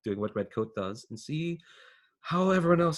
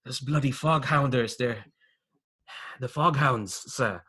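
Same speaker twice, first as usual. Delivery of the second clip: put-on accented small-time crook, hesitant and raised; hedging informant underling; 3 semitones higher, 3 dB louder than usual. Underling informs on the Foghounds to his boss, defensively. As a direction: spill the rumour haltingly, then protest your innocence.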